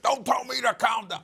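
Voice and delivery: high, loud indignant snap back; family member yelling back at whoever says calm down; angry retort. Retold or imitated. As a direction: bristle at being told to calm down. Angry man retorts in family scene.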